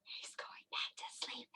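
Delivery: very soft stage whisper; hushed whisper. Pretend. Teacher watching the pretend bear doze off, hushed whisper. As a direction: whisper so the bear stays asleep.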